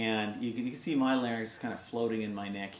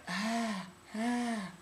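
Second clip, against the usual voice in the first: high, slow, soft breathy ah; high larynx sound; 10 semitones higher, 4 dB quieter than usual. Shown as a demonstration. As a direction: squeeze the larynx up, thin and airy. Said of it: "the sound of a high larynx is like".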